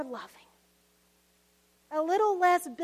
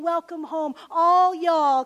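Southern twang; 11 dB louder than usual. Retold or imitated. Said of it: "he probably's got a good Southern twang".

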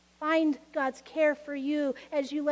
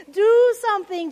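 louder, higher demand; shouted prayer; 5 semitones higher, 9 dB louder than usual. Retold or imitated. Martha shouting her prayer at Jesus, louder and higher. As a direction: fling the plea upward. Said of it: "Anyone shout a similar prayer".

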